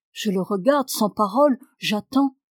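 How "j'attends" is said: ordinary delivery, nothing marked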